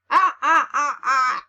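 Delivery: loud staccato evil cackle; hammed-up, punched-out villain ha-has; villain laugh.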